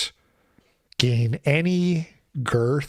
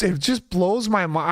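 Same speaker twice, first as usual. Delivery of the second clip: usual speaking voice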